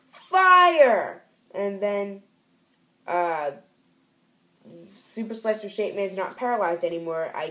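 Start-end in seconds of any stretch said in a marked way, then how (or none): 0.1-1.2 a high, loud, drawn-out shout; screamed "Fire!". Acted. Villain screaming about the fire in the comic. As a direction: scream it like the flames just erupted. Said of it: "he just screams".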